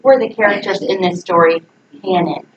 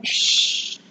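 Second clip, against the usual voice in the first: long unvoiced shush; 8 dB quieter than usual. Real, not acted. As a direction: hush the class. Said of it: "hushing the class".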